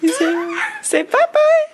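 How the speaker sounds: very high squeaky coaxing through laughter. Real, not acted. Mother giggling as she coaxes her baby to say bye-bye.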